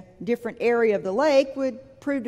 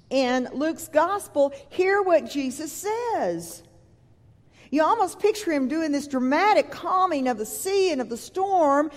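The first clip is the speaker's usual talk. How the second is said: ordinary talk, nothing marked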